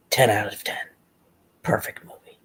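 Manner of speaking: whisper. Real, not acted. Hushed verdict on Ghost Rider.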